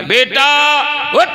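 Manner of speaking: short rising shout to wake someone; mock yell, pitch leaping on the last word; imitated yell. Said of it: "फिर चिल्लाया". (चिल्लाकर जगाने की नकल)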